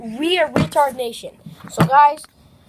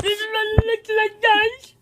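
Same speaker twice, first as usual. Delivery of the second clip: higher, louder mocking copy; mocking imitation; 8 semitones higher, 5 dB louder than usual. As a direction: parrot her line back in a silly voice. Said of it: "this is how she goes".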